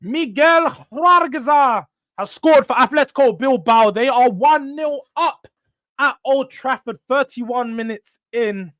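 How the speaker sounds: slow, high announcer call; commentator voice. Acted.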